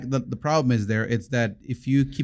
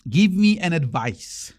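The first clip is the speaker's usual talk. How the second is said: slower, each word spelled out for the listener; slow, drawn-out repeat; 3 semitones higher, slower than usual. Shown as a demonstration. Repeating slowly after the host asks what was said. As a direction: say it again slowly so she catches it. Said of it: "What do you say?".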